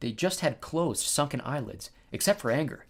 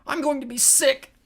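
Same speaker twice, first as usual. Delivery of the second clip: high, louder warning voice; queasy warning; 13 semitones higher, 5 dB louder than usual. A narrator's reading. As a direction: warn them, pitched right up. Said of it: "Tyson warned".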